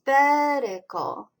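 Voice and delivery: "betical" drawn out, first syllable punched; slow, emphatic BET-i-cal for "betical"; stress on BET. Shown as a demonstration. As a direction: say "betical" slowly, leaning on bet. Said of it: "The strongest stress is".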